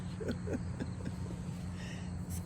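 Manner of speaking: a breathy laugh under the breath; stifled giggle, almost silent; whispered laugh. Real, not acted. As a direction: let a soft, voiceless laugh slip out. Reader breaks off, whispering.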